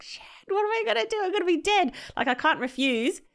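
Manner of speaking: high, fast, panicked yelp. Retold or imitated. Player panicking as he's called onto the pitch, high frantic yelp. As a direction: squeal it out in sudden dread.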